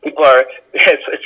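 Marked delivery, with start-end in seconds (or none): none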